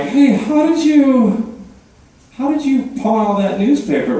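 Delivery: mock shopkeeper voice. Mock pawnshop guy quizzing hoarder.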